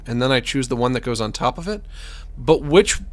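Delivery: normal talking voice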